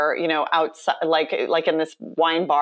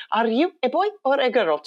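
put-on high-pitched child's Icelandic accent; 7 semitones higher, faster than usual. Little girl going down the line, imitated Icelandic accent. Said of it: "she's in her little Icelandic accent".

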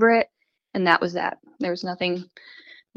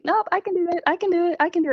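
eager self-assurance; 9 semitones higher, faster than usual. Retold or imitated.

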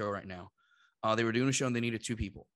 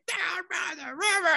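imitating Chris Farley skit. Quoting Chris Farley SNL skit.

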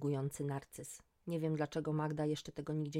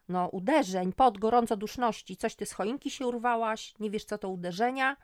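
louder, higher exasperated explaining; irritated; 5 semitones higher, 8 dB louder than usual. A narrator's reading. Magda explains hot flushes to her clueless friend, irritated. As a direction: spell it out impatiently, forcefully. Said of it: "Magda była poirytowana".

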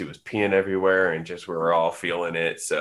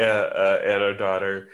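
usual speaking voice

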